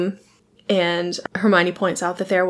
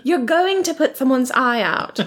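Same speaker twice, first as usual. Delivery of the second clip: higher-pitched scolding quote; Hermione; 6 semitones higher than usual. Retold or imitated. Bossy classmate warning Ron, imitated in higher pitch. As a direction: imitate a know-it-all pupil scolding. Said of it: "And Hermione's like".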